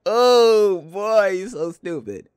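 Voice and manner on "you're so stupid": ordinary delivery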